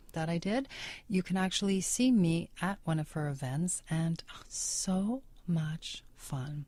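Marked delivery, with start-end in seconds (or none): 3.9-6.7 whispered aside. Real, not acted. Breathy aside about her events.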